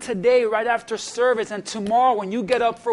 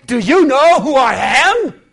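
mock entitled; 2 semitones higher, 8 dB louder, slower than usual. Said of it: "Jesus didn't say".

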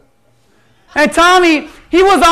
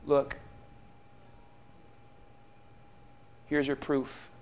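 much softer, lower, tender offer; Jesus gently showing Thomas; gentle; 9 semitones lower, 20 dB quieter than usual. Acted. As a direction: say it softly, no anger. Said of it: "He just says".

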